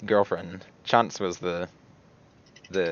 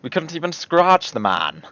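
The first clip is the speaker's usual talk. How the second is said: high, louder hammed-up British imitation; mock British accent; 8 semitones higher, 5 dB louder than usual. Mocking a player's sudden accent, exaggerated high British. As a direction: tease with a posh-British "scrahtch", pitched up. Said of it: "like, British?".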